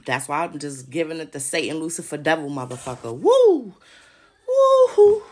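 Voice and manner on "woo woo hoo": high, loud gleeful whoop; screeching woo-hoo of glee. Real, not acted. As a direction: let out a wild triumphant holler. Whooping after devil boast.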